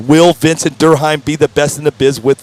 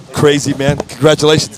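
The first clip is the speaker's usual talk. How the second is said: usual speaking voice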